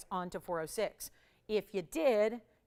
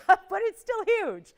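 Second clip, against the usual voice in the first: laughing it out, high and loud; host laughing that the ring is still huge; 10 semitones higher, 11 dB louder than usual. Real, not acted. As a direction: laugh through the words, amused by the size. Laughs about tapered ring size.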